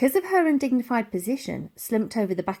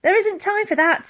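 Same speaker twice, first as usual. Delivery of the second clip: put-on girl's voice; 11 semitones higher, 5 dB louder than usual. A narrator's reading. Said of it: "said the girl".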